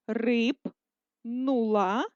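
рипнула stretched into syllables, very slow; slow syllable dictation. Shown as a demonstration. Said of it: "продовжуємо вже з маленької літери".

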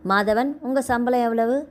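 ordinary delivery, nothing marked

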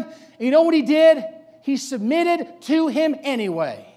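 high, loud sermon delivery; full-force preaching. Real, not acted. Preacher challenging congregation about Samuel's submission, full-force preaching. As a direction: drive each question at the congregation.

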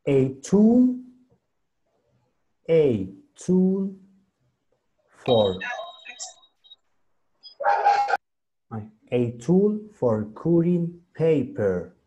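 careful teacher read-aloud; slow reading aloud. Shown as a demonstration. Teacher reading the English text to the class, slow and clear. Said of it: "Voy a leerles la siguiente imagen".

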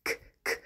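"K, k" as two voiceless clicks of k; letter K sound. Shown as a demonstration. Teacher sounding out the start of kite, voiceless "k". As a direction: puff out "K, k" with no vowel. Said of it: "The K says k".

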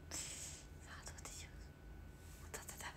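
soft unvoiced whisper. Real, not acted. Streamer musing between comments in a whisper. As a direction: murmur it to yourself. Quiet aside while reading.

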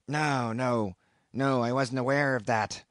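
a lightly voiced odd old sailor, musing; puzzled, in no hurry; slow and wondering. A narrator's reading. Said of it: "slowly and wonderingly".

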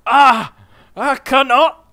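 high, loud pained cry ending in a groan; hammed-up dying gasp and groan; pained collapse. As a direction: give way with a loud agonised groan. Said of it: "he's passed out from the pain".

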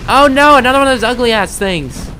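squealing in disgust at an ugly monster; disgusted shriek. Real, not acted. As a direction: shriek at the thing to get away.

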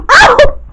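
short high ow of pain; a playful pained ow; mock yelp. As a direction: yelp as if bumped. Toy bumped, cries out.

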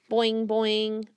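bouncy spoken boing; boing sound. Acted. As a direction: pop out a cartoon boing. Frog jumping noise.

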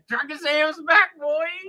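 high, slow mock American cowboy twang. Shown as a demonstration. Redoing his American accent when told not to yell, cowboy-style. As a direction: put on a cowboy American accent without full yelling. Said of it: "Do it normal without yelling".

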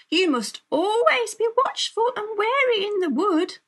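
high, emphatic parental warning; cautioning storybook parents; parents' warning. A narrator's reading.

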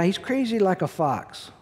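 ordinary talk

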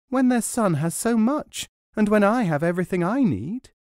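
high, eager questioning woman; eager village woman. Acted. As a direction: ask like a kind, simple wife. Woman pleads for in-laws.

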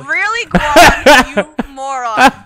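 loud, slow, laughing jeer; loud mock insult. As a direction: bellow the insult and laugh through it. Reading a teammate's name aloud.

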